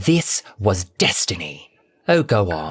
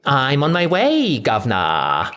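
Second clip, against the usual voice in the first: bright, faked Cockney cheer; false enthusiasm; 5 semitones higher than usual. A narrator's reading. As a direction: trowel on the slang. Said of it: "with false enthusiasm".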